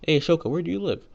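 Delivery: called out high and loud; calling out. Retold or imitated. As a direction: reach someone walking a way off. Calling out to Shoka.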